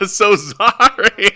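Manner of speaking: slow apology through laughter; laughing. Real, not acted.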